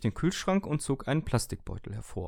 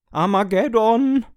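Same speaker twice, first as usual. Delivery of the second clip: much higher, loud, slow howl; wailing; 12 semitones higher, 13 dB louder, slower than usual. Acted. Toaster wails at its owner's verdict, loud howl. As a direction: howl it like the world is ending. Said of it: "heulte plötzlich der Toaster los".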